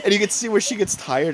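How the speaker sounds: ordinary talk, nothing marked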